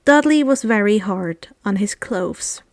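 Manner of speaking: plain reading voice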